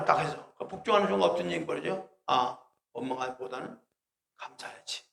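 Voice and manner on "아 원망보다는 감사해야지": soft, hushed self-talk; softly voiced inner thought. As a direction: murmur it to yourself.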